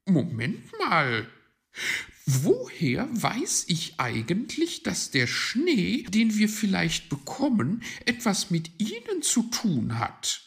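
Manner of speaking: high, loud magpie voice; suddenly doubtful bird figure; suspicious magpie. Acted. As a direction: question it sharply, pitched well up.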